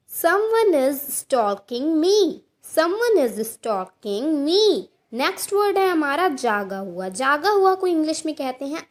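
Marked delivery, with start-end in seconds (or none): 0.1-4.9 slow, careful teacher read of each word; slow model sentence. Shown as a demonstration. Teacher reading an example to Hindi learners, slow and clear. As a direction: say it slowly so students can repeat. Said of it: "teacher models example slowly".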